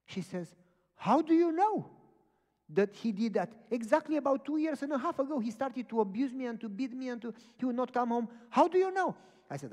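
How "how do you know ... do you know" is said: high, loud imitation of a stunned woman; astonished outcry. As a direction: exclaim it at full volume, pitched way up. Astonished woman's reply, retold.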